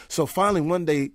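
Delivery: normal talking voice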